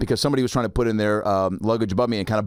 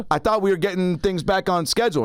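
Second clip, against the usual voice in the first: roadie voice; 8 semitones higher than usual. Retold or imitated. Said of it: "So it was like".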